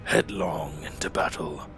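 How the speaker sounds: Lich King voice. Acted. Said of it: "I'm trying to get the Lich King voice back".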